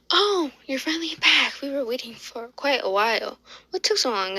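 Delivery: high-pitched, exclaiming relief; impatient waiting mother. Acted. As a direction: voice a mom who has been waiting. Mother greets doctor, acted.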